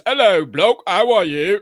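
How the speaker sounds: loud, hammed-up British voice; deep-voiced British impression. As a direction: put on a booming mock-British accent. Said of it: "real deep voice like this".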